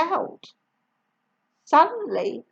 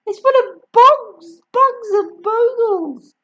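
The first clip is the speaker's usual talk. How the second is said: high, loud urgent warning; boy warning Grandma off the attic; warning call; 10 semitones higher, 8 dB louder than usual. Acted. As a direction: shout up urgently. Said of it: "George called out".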